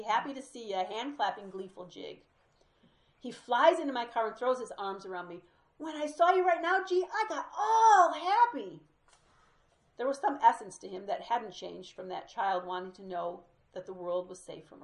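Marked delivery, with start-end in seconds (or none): 5.8-8.8 overjoyed. Retold or imitated. Ex-con overjoyed hugging his friend.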